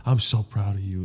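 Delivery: soft, hushed whisper of pride; tender pride. Retold or imitated. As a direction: speak lovingly down to the Earth, very softly. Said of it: "he's in space looking at Earth and he's just like".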